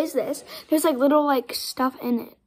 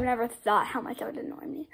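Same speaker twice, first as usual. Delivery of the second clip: breathy whispered aside; whispering; 3 semitones lower, 9 dB quieter than usual. Real, not acted. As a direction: whisper it confidentially. Aside about annoying noise.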